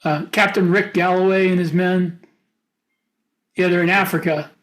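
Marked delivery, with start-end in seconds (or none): none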